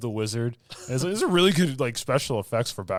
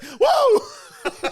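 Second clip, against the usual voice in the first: high, loud shout of delight; excited whoa shout; 10 dB louder than usual. Retold or imitated. Friend imitates Hayden's thrilled whoa, high and loud. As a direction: shout as if stunned with joy. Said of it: "He's like".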